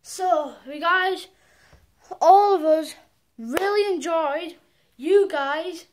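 loud, hyped YouTube sign-off; energetic outro. Acted. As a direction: shout the outro to your fans.

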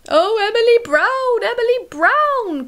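high, loud whiny monster wail; grumbling creature. A narrator's reading. The thing grumbling to Emily Brown, a high loud wail. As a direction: moan her name like a big fussy beast. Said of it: "coughed the thing".

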